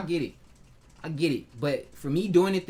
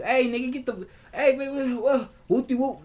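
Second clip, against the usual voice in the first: higher, louder mock yell at people who won't listen; 7 semitones higher, 3 dB louder than usual. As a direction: haul off and holler at them. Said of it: "haul off and yell at people".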